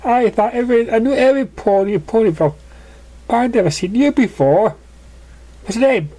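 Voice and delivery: high, eager chatter; bubbly Pinkie voice. Acted. Pinkie eagerly asking the new pegasus her name, high and bubbly. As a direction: gush at her new friend.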